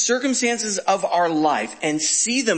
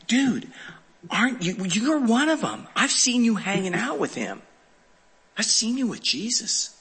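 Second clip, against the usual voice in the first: lightly acted servant girl, higher pitched; servant girl's accusation; 5 semitones higher than usual. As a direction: point him out as someone you recognize, pitched up. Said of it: "the servant gal is talking to him saying".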